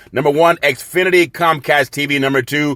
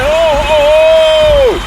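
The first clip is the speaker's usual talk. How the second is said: much higher, louder shouted ho ho ho; shouted Santa laugh; 12 semitones higher, 6 dB louder, much slower than usual. Acted. Host shouts a Santa laugh after the Cadillac plug. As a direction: bellow it like an over-the-top Santa.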